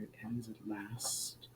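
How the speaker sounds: faint, slow whisper; whispered relief. Acted. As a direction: breathe it out as despair ends. Spirit's weary closing line.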